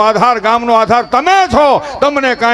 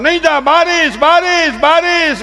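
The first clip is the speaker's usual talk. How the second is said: high, pampering sing-song; mock the overprotective mom; mother keeping her child in from the rain, high fussy sing-song; fussy modern mother; 9 semitones higher, 4 dB louder, slower than usual. Pampering mother warns about rain.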